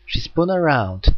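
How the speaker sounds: plain reading voice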